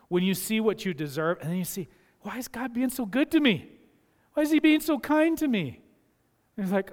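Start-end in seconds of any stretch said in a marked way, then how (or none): 2.2-5.8 amazed wonder. Acted. Voicing a believer's amazed realisation.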